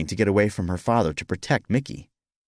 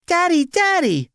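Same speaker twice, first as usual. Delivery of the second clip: put-on very high, loud child's call; excited little girl; 6 dB louder than usual. A narrator's reading. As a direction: call out to Daddy like a four-year-old. Four-year-old daughter runs to father.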